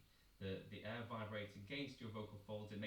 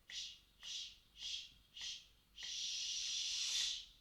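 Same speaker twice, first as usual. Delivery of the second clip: shh crescendo; much slower than usual. Shown as a demonstration. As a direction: start gentle then swell the last shh. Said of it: "we're gonna do a crescendo".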